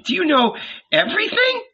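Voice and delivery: high, awed question; full of admiration. Acted. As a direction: marvel at him. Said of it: "filled with admiration".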